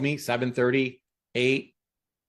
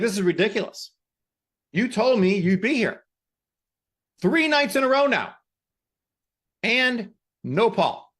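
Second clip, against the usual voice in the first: exasperated friend losing patience; furious Linda; 6 semitones higher than usual. Retold or imitated. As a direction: scold him for standing her up three nights.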